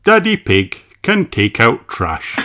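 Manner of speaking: plain reading voice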